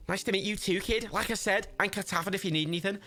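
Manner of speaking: high, thick Scouse chancer; high-pitched cartoon Scouser; Scouse Jimmy. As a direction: argue in a squeaky Liverpool accent. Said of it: "Never heard a Scouse person".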